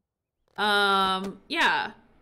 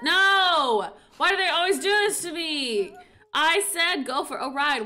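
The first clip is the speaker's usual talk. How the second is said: louder, higher complaint; mock frustration; 5 semitones higher, 5 dB louder than usual. Real, not acted. Her Sim keeps getting off the horse, exasperated. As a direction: whine in exasperation.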